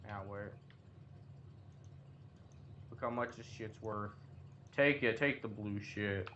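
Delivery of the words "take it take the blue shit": ordinary talk, nothing marked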